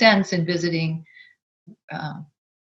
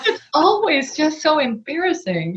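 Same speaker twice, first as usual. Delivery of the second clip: laughing through the words, higher; 9 semitones higher than usual. Real, not acted. Laughing to a friend about being embarrassed. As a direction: giggle it out.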